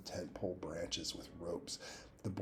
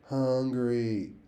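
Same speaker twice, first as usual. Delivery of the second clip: falling, louder moan; 5 semitones higher, 9 dB louder than usual. A narrator's reading. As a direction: drag the word out with a moan. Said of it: "you moaned".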